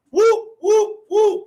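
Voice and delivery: high cheering whoops; excited whoop. Real, not acted. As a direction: whoop it up for the grads. Cheering graduates.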